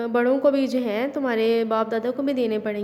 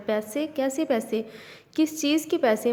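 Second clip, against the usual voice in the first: ordinary delivery, nothing marked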